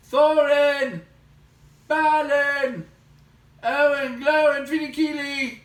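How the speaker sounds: lost hobbit shouting for help; calling out. Acted. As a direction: shout the names as loud as you can. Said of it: "he cried as loud as he could".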